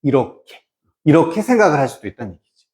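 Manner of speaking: normal talking voice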